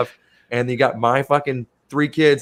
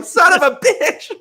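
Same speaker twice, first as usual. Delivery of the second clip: laughing; 7 dB louder, slower than usual. Retold or imitated. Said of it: "The guy was like".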